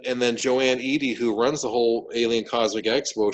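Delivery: usual speaking voice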